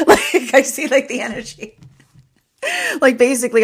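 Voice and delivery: laughing. Real, not acted. Laughs while describing the energy.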